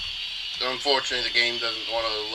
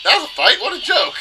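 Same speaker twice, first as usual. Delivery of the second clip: high, loud mocking disbelief; scoffing; 7 dB louder than usual. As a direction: scoff at a feeble fight. Character scoffs at the fight.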